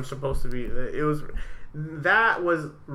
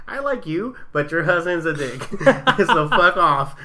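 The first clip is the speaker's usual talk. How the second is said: laughing mock blunt; 3 semitones higher, 6 dB louder than usual. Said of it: "I wanted to tell her".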